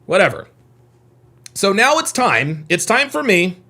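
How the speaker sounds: usual speaking voice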